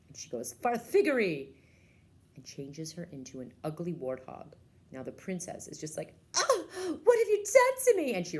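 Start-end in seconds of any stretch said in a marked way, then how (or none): 0.5-1.5 loud, high magic word; spell incantation. Acted. Witch casting spell. 6.3-8.1 very high, loud anguished cry; wailing princess. Acted. Princess transformed into warthog.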